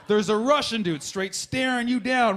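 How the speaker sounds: ordinary talk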